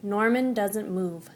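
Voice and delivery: ordinary delivery, nothing marked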